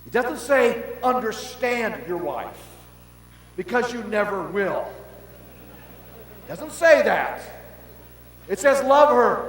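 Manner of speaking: loud, high preaching build; shouting sermon passion; fiery preaching. Acted. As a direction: preach it with full fire. Preaching at full force.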